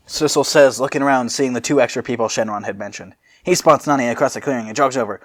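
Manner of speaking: normal talking voice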